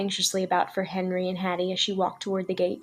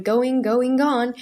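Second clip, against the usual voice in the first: auctioneer's cry; 4 semitones higher, 4 dB louder, much slower than usual. A narrator's reading.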